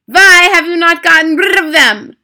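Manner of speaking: put-on high, loud accented tirade with rolled Rs; screaming Grand High Witch. A narrator's reading. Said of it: "she screamed".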